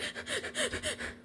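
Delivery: imitated rapid, high panicky panting; shallow breathing. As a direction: pant in quick, shallow little breaths. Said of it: "it's really shallow".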